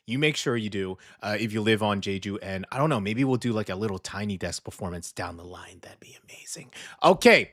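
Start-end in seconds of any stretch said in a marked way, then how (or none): 3.5-6.7 unvoiced hushed aside; soft conspiratorial whisper. Real, not acted. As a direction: whisper it like a secret hope. Whispered aside about a performance.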